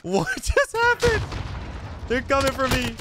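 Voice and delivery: high, laughing panic at a sudden ambush; startled. Real, not acted. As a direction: yelp it out, caught off guard.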